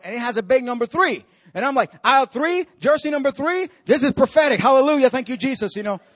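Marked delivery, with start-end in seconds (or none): 2.0-5.8 higher, mounting amazement; thrilled retold outburst; excited realization. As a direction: build from astonished questions to joyful praise. Thrilled at a matching sign.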